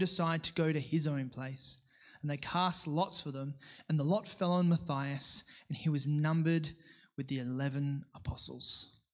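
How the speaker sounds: steady formal reading voice; scripture reading. Acted. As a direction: read the passage out to the congregation. Reads scripture aloud.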